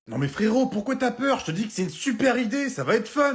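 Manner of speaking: high-pitched, eager pleading; hyped-up buddy with a wild idea; excited urging. Acted. Excited friend pushes a daft idea.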